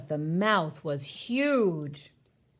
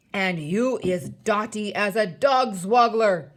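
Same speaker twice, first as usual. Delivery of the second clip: loud giant retort, building to the end; booming giant jeer; cried insult; 7 dB louder than usual. A narrator's reading. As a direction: bellow the insult back. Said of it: "cried the Blood Bottler".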